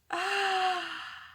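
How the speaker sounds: long, high-pitched gasp; shocked gasp. Real, not acted. Reliving the shock of it.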